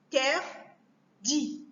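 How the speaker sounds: "Interdit" very slowly as In... ter... dit; three isolated beats in "Interdit"; split into syllables. Shown as a demonstration. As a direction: break "Interdit" apart with long pauses between syllables. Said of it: "couper les mots en syllabes".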